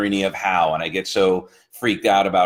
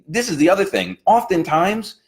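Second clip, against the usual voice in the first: pitched much higher, eager motivational push; fired-up coach voice, much higher; heightened inspiration; 9 semitones higher than usual. Acted. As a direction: lift it up like a pep talk to a client.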